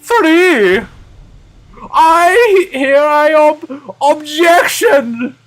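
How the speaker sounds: very high, drawn-out shout; loud theatrical courtroom cry; shouted objection. Acted. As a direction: stretch it out slow and high. Character shouts an objection aloud.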